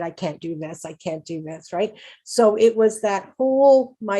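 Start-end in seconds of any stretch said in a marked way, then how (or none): 0.0-1.7 soft, deflated imitation of giving up; mock defeated. Patient imitating a hopeless voice she never had, soft and deflated. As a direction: mutter it like someone who has given up. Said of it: "I was never said".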